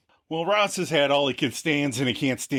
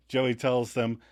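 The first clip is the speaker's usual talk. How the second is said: ordinary delivery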